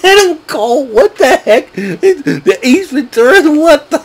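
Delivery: high-pitched giggling words through laughter; can't get the words out for laughing, real; cracking up laughing. Real, not acted. Fan recapping wrestling show breaks into helpless laughter. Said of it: "I'm cracking up".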